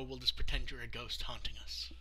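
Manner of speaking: ordinary talk, nothing marked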